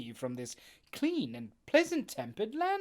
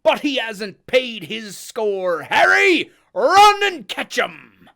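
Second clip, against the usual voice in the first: loud, high, slow shout; crying out; 8 semitones higher, 17 dB louder, slower than usual. Acted. Silver shouts for Harry to chase, very loud. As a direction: bellow the order to chase. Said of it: "cried Silver".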